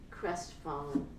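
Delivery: ordinary delivery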